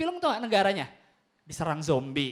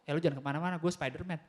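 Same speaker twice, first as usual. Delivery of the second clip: low quiet threat; 7 semitones lower, 7 dB quieter than usual. Acted. Undercover hero quietly busts robber.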